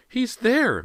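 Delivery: very high, loud shriek. A narrator's reading. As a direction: shriek it out pointing. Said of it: "Missus Fraser shrieked".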